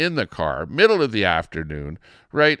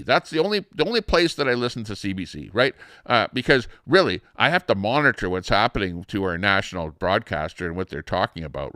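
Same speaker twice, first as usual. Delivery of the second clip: ordinary talk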